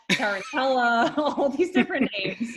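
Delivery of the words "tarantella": tarantella said like the dance, ta-ran-TEL-la, slowly; name mangled into tarantella, drawn out; mispronounced name. Retold or imitated. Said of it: "Not everybody can do that".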